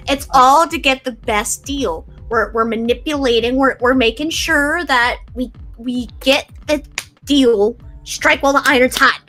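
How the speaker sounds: ordinary talk, nothing marked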